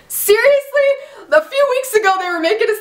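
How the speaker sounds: incredulous at the writers; outraged disbelief. Real, not acted. Reacting to Ezra's death.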